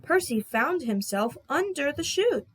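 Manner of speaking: ordinary delivery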